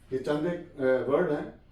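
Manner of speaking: ordinary delivery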